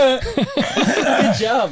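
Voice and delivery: high laughing praise. Real, not acted. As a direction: laugh through the words.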